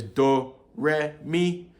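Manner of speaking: "do, re, mi" stepping upward; three tones. Shown as a demonstration. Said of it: "three tones for Yoruba".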